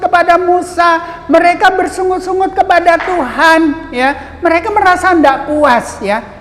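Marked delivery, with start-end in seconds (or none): none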